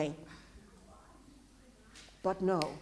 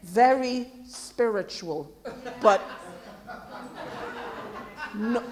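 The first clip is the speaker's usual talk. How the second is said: mocking onlookers; 5 semitones lower, 4 dB quieter than usual. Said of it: "They will think".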